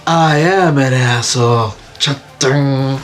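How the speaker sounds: slow, lightly put-on character voice; a hint of deadpan cartoon delivery; Gangle. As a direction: draw the admission out slowly. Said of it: "And then Gangle's like".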